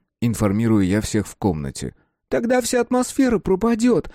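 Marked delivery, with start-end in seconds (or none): 2.3-4.1 put-on high whiny kid voice; complaining child. A narrator's reading. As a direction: whine it like a disappointed boy. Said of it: "жалуется Марк".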